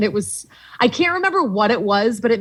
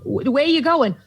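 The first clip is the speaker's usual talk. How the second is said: put-on high-pitched mom with a Boston accent; 7 semitones higher than usual. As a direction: imitate mom's Boston-accented questioning. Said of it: "so this is the accent".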